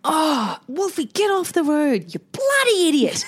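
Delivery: put-on high, sharp scolding cry at a child near the road; frustrated yell at son. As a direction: yell at him in sudden frustration. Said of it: "I've never called him a bloody idiot".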